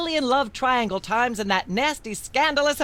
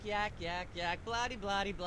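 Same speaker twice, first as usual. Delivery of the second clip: background chatter; 15 dB quieter than usual. Acted. Myra drones on beneath talk.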